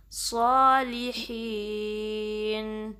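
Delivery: very slow, drawn-out recitation of the greeting. Shown as a demonstration. Teacher reciting the phrase for students to repeat. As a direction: stretch each vowel in a measured chant. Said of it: "نون ... واضح کریں گے".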